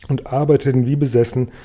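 ordinary delivery, nothing marked

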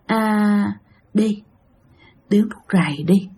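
a soft, sweet whisper-like coaxing; sweet ghostly woman's voice. Retold or imitated. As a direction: lure him gently like a phantom woman. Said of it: "Nói mà ngọt ngào lắm ba".

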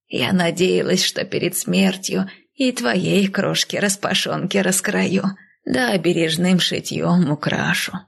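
put-on slow, tender elderly reminiscence; wistful, aged voice; old nanny. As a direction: cherish each memory as a doting old woman.

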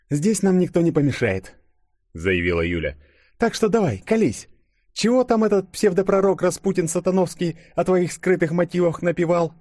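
high character voice, demanding; insistent Yulia. A narrator's reading. Yulia cornering Denis about his motives, insistent. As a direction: press him for answers, pitched well up.

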